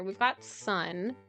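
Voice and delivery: normal talking voice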